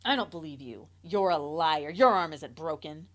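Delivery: loud, scornful accusation; mother accusing, loud and cold. A narrator's reading. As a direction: call the child a liar, loudly. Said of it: "she accused".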